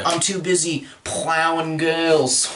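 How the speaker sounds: a hint of a laid-back Australian slacker; Australian hipster. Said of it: "I can do an Australian hipster".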